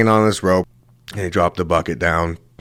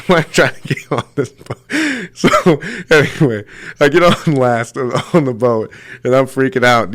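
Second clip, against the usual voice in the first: laughing; 5 semitones higher, 3 dB louder than usual. Real, not acted. Laughing retelling escape.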